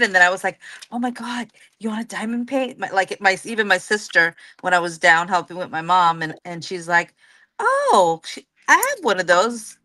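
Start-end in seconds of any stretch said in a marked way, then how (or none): 0.9-2.8 retold excitement, higher and softer; a hushed, high-pitched mock gush; excited. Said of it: "I was so excited". 7.6-9.8 high-pitched imitated "oh"; surprised recognition. Retelling sister's surprised recognition.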